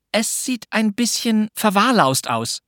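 put-on high, slow girl's voice; little girl. A narrator's reading. Pünktchen judges the house, high and drawn out. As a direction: voice a girl weighing her words. Girl answers in narrated dialogue.

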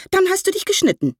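the aunt puffs herself up and refuses to stay behind; defiant old aunt. Acted. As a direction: voice jumping high in stubborn outrage.

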